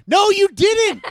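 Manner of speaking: mad outburst. Retold or imitated. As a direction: blow up at him in mock fury. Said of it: "I was getting so mad at him".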